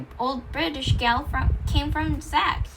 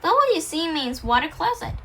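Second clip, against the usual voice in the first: ordinary delivery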